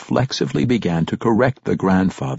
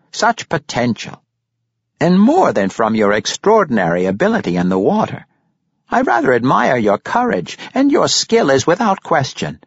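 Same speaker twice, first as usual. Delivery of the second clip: high, louder grandfatherly praise; admiring Pericolo; 9 semitones higher, 5 dB louder than usual. Acted. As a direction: enthuse in a high character voice. Narrator voices admiring Pericolo.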